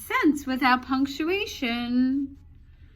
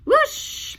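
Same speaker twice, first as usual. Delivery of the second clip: high, loud rising whoosh; loud whoosh as the marks rush out; whooshing sound; 6 dB louder than usual. Acted. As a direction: sweep the word up like wind rushing out. Sound effect in story.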